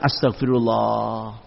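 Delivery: low, soft and slow, a lightly voiced contrite wife; softly repentant, just a hint of the wife; mild penitent sigh.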